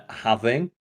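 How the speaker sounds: ordinary delivery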